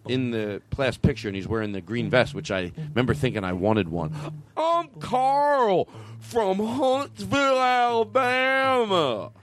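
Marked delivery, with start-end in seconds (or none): 4.5-9.3 very high, slow Southern impression; Alabama accent. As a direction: draw it out in a thick Alabama accent. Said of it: "this is a, uh, a, an Alabama accent".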